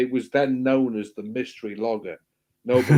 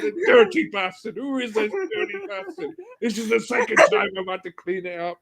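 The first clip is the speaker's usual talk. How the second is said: higher mock Scottish grumble; Scottish caretaker; 10 semitones higher than usual. Caretaker cursing over the toilet, mock Scottish grumble. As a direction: lightly voice the angry Scottish caretaker. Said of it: "he's like".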